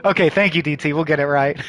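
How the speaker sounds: ordinary talk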